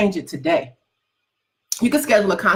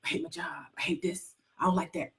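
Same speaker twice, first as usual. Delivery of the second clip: soft, half-whispered griping about the job; mock whiny complaint; 4 semitones lower, 5 dB quieter than usual. Imitating her man moaning about his job, soft and whiny. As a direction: mimic his tired moaning, quietly. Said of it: "he complained about his job".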